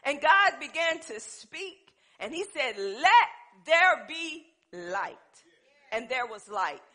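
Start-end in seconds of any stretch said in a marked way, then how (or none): 2.8-5.2 very slow, loud divine command; thundering voice of God. Acted. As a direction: boom it out as God. God speaks, retold louder.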